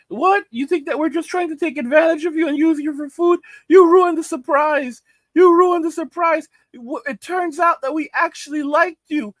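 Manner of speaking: mock a woman guilt-tripping a man, high and put-upon. Vlogger mocks women gaslighting men, in a high whiny impression. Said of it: "they're trying to gaslight men like".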